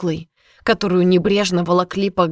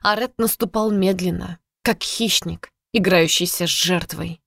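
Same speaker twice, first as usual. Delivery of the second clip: plain reading voice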